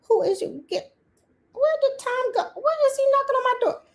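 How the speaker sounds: very high comic squeaky voice; mock startled squeal. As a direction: squeak it in playful disbelief at an unwelcome caller. Acts startled answering forty-five's knock.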